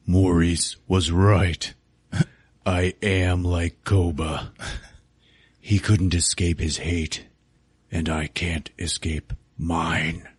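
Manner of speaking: slow, solemn ape leader voice; measured, low-ending confession in heavy pauses; Caesar. Acted. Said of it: "Caesar looks at him and he's like".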